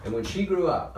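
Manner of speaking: ordinary delivery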